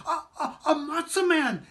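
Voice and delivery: put-on high, breathless child's cry of delight; children exclaiming in delight; excited exclaim. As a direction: squeal it out like a thrilled kid. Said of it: "exclaimed the children".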